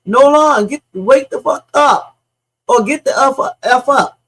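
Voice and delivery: loud and high, urging someone awake; mock-frantic yell at a limp body; retold frantic yelling. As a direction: shout it like you're shaking a passed-out friend. Retelling shouts heard on audio.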